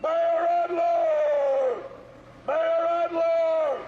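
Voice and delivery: long, slow, full-volume shouts at a crowd's edge; shouting a name. Real, not acted. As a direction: bellow the mayor's name across the street. Calling out at a protest.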